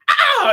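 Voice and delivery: high, loud imitated scream; her scream. Startled Karen shrieks as the man approaches, imitated scream. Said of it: "you hear her go".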